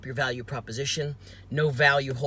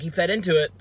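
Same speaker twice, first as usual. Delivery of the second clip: ordinary delivery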